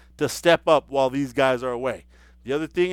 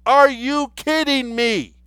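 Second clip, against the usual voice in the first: incredulous; 7 semitones higher, 4 dB louder, slower than usual. Acted. Mocking disbelief at critics.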